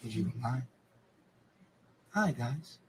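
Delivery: put-on hushed sweet kids' show host; Mister Rogers voice. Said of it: "like Mister Rogers".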